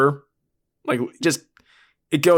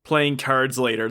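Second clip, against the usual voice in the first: normal talking voice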